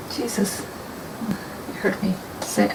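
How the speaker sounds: usual speaking voice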